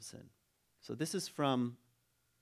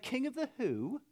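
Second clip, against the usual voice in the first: very high, incredulous English peasant; mock English accent; 12 semitones higher than usual. Peasants question the king, high mock English accent. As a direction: squeak the question in a badly faked English accent. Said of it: "And they're like".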